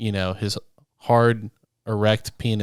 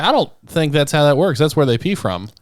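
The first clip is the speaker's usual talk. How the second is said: louder, higher correction; incredulous; 6 semitones higher, 5 dB louder than usual. Retold or imitated. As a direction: correct him with disbelief. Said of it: "I was like".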